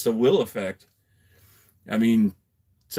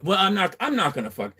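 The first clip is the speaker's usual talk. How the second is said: normal talking voice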